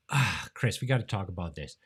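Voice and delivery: normal talking voice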